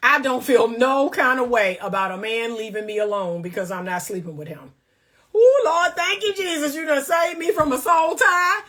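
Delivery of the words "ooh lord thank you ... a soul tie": hammed-up church shout of relief; joyful mock praise. Thanking Jesus for a lucky escape.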